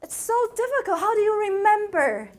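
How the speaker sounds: hammed-up disbelief at a hard language; amazed listeners. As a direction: gush like an amazed outsider. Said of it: "they're like".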